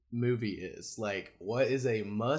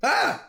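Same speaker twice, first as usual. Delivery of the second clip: sudden loud jump-scare yell; startling boo; 10 dB louder than usual. Pretend. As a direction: shout to startle the listener. Did I scare you?.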